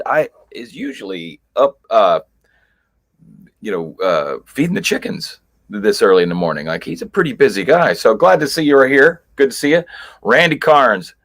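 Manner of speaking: normal talking voice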